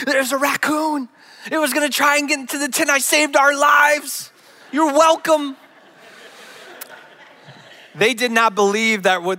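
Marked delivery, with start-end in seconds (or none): 0.1-5.6 high, strained insistence; worked-up husband justifying himself; frantic self-defence. Retold or imitated. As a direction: plead your case in a raised pitch. Defending himself to bewildered family.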